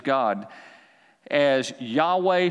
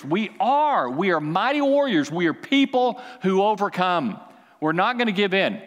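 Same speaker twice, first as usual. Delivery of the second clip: rallying preach; 4 semitones higher than usual. Acted. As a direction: rouse the crowd. Preacher rallies congregation.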